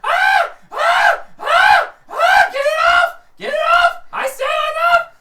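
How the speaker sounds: very high, very loud panicked screams; panicked screaming. Acted. Thug dragged by the dog, shrieking high and loud in panic. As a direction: shriek in terror, begging it off.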